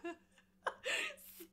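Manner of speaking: loud startled yelp of no and stop; laughing shout. Real, not acted. Shouting at her misbehaving cat, laughing. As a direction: yell at the cat through laughter.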